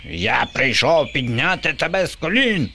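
a hint of a screeching zombie; mild zombie shriek. A narrator's reading. Bob screeching at little U on her doorstep, mildly. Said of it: "верещав Боба".

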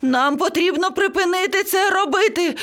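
very high, frantic squawk; screeching panic. A narrator's reading. Parrot begging everyone to stop, screeching. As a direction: plead in a high-pitched panic. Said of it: "зарепетував папуга".

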